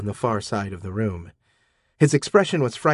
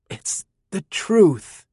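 slow, higher and strained; 9 semitones higher, 4 dB louder, much slower than usual. Acted. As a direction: force it out under pressure. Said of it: "Ross managed".

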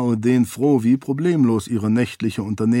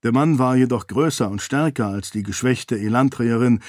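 ordinary delivery, nothing marked

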